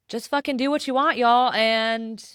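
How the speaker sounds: rally your listeners to just go for it; excited exhortation. Real, not acted. Host urging podcast listeners to follow their own path, fired up and loud.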